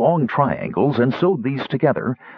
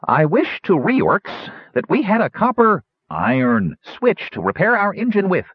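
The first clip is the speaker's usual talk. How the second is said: high, grand gnome declaration; declaring; 9 semitones higher than usual. A narrator's reading. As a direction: proclaim it like a formal wish. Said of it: "and declared".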